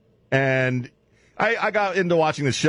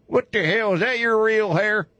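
hillbilly yokel; 9 semitones higher than usual. Pretend. Hillbilly mocking Dog.

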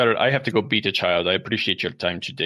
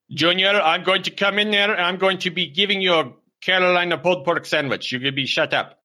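angry father; 8 semitones higher than usual. Acted. Father threatening Junior mid-call, raised high scolding voice. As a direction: threaten him like an exasperated dad.

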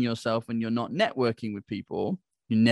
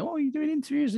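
much higher, gushing attendee voice; mock excited attendee. Attendees gushing at him about interviews, mock excited high voice. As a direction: squeal it like an eager fan.

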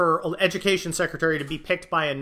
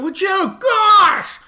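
much higher, louder snap at the dogs; real exasperated yell; 9 dB louder, much slower than usual. Real, not acted. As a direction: lose patience with the barking. Dogs interrupt again.

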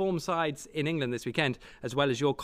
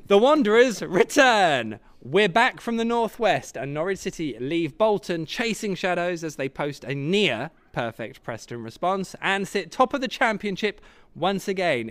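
headline read; 4 semitones higher, 5 dB louder than usual. Acted. Reading the weekly headlines.

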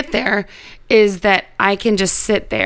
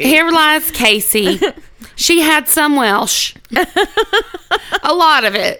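put-on Southern accent; 7 semitones higher than usual. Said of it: "they say it in a southern accent".

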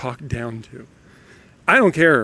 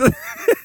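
high giggle. Real, not acted. Laughs at own impression.

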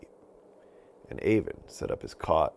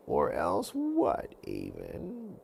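much higher, slow mocking witch voice; taunting witch; 6 semitones higher, much slower than usual. Witch mocking Avon's threat, slow high taunt. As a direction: dare him to try.

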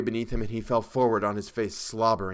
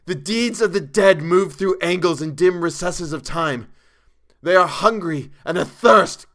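high, loud, frantic raving; hysterical; 9 semitones higher, 10 dB louder than usual. A narrator's reading. Chalmers pacing the room over the deeds, raving hysterically. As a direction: rave in horror, voice raised. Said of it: "was hysterically pacing the room".

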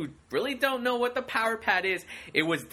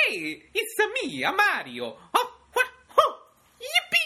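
much higher hammed-up cartoon plumber; Mario voice. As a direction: squeak out a gleeful video game hero. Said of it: "the voice of Mario".

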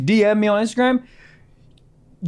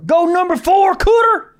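higher, louder mock cheer from the stands; nascar fan cheer; 9 semitones higher, 4 dB louder than usual. Host imitating a nascar fan cheering a driver, mock cheer. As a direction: cheer your favorite driver, lightly hammed. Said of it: "at a nascar race and that's your favorite driver".

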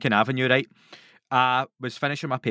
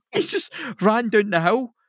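normal talking voice

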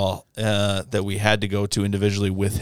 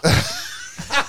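high burst of laughter; real high-pitched laughing fit. Real, not acted. Laughing at the second botched name.

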